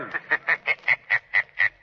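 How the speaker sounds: a low, menacing chuckle of triumph; villain's gloating laugh. Acted. Richter gloats over the captured Shadow with a low, menacing laugh. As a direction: gloat as the trap closes.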